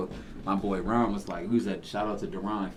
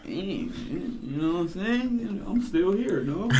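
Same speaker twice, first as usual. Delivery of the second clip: retelling his reaction; 10 semitones higher than usual. Retold or imitated. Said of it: "I was like".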